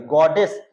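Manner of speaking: Goddess with the stress hit on the last syllable -days, rising; stress on goddess. Shown as a demonstration. Teacher showing students the stress, goddess with the weight on -days. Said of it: "stress है यहाँ पे".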